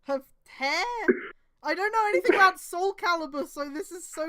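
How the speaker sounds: baffled outburst. Real, not acted. Reader confused by the Soul Calibur lore, loud and baffled. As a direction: throw up your hands at the plot, loudly.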